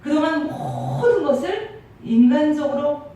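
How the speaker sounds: loud, driving sermon climax; preaching at full force. Acted. Pastor confessing to the congregation, loud impassioned preaching.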